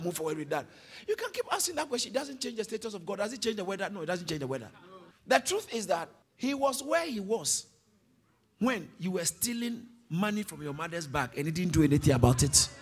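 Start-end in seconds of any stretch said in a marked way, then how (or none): none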